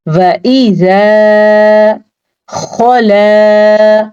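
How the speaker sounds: slow, drawn-out tajweed chanting; Quranic recitation. Shown as a demonstration. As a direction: recite slowly, stretching each vowel. Recites the verse words. (धीमी तिलावत)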